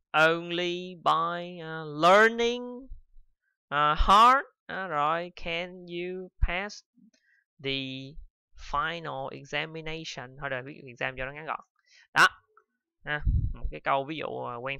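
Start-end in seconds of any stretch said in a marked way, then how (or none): 0.1-10.4 "Only by learning hard, can you pass" read very slowly, word by word; slow dictation. Shown as a demonstration. A teacher dictates the example sentence slowly. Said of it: "một chấm hai".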